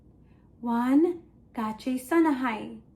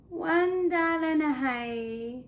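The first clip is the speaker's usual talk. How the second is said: weary, drawn-out falling delivery; tired; slower than usual. Shown as a demonstration. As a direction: let the words droop slowly as if exhausted. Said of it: "I'm so tired".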